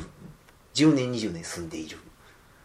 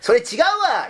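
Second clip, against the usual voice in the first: loud, high-pitched mock outburst; overly loud Kansai; 8 dB louder, slower than usual. Imitating a narrator overdoing Kansai dialect, very loud. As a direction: blurt it out far too loud, imitating. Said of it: "声がめっちゃでかかったんですよ".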